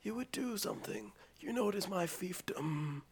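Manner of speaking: hushed mock villain threat; lightly imitated whisper-yell; stage whisper. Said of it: "But he's like".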